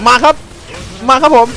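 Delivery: high, loud taunt; loud call. Real, not acted. Gamer calling enemies to come, loud high taunt. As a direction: shout them over to you.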